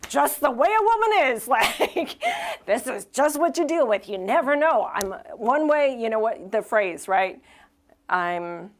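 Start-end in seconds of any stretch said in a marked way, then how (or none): 0.1-4.9 higher, louder, laughing mock-weary husband; knowing husband. Pastor imitating men in the room, in a laughing mock-weary voice. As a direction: shrug it off like a long-suffering guy. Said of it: "men in this room who are like".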